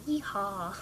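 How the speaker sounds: playful attempt at a Southern yeehaw; Southern accent. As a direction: lightly put on a Southern accent. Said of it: "that's my Southern accent".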